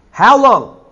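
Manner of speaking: a loud, high cry of pain to God; anguished cry. Acted. Psalmist crying out to God, loud and anguished. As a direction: call out to God, aching and desperate.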